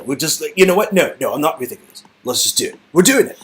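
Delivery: ordinary talk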